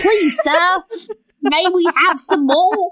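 high, pleading street urchin; shoeshine boy. Retold or imitated. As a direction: beg sweetly like a Dickens orphan. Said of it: "my terrible street urchin voice".

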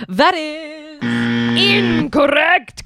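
high, slow, drawn-out announcement; game-show verdict. Acted. Host tells contestant the answer is wrong, drawn-out showy verdict. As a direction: stretch out the big reveal.

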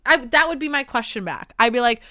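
ordinary delivery

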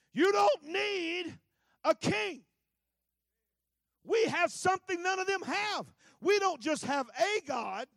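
loud, high, impassioned sermon voice; full-force preaching. Acted. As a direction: thunder it from the pulpit. Samuel's rebuke, preached loudly.

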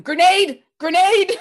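high, loud panicked yell. Pretend. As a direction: shout the warning as you flee.

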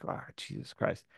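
under-the-breath whispered groan; exasperated whisper. Retold or imitated. Retelling a call with customer service, exasperated whisper. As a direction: whisper it like you're fed up. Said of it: "I'm like".

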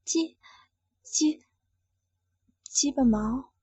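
stammering whisper, reluctant; hesitant stammered whisper; small voice. Acted. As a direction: barely breathe the words out, stuttering. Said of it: "只好小声说道".

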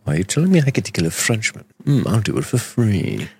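quick, lightly put-on posh British voice; a hint of a posh English killer; mock posh British accent. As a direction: play an eager upper-class British assassin. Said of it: "posh British accent".

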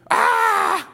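high, loud, sustained "aah" of terror; terrified fly's scream. Acted. Little fly looks up at a passenger, screaming in mock terror.

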